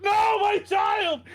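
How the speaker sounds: high, loud hammed-up cry of grief; overacted wail. Mother screaming over her dead baby, hammed-up wail. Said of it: "I'm overacting this way too much".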